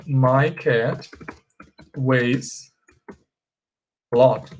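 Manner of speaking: slow, spaced words for the class; slow model sentence. Shown as a demonstration. Teacher showing students the correct sentence, slow and spaced. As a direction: lay each word out slowly. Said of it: "ó".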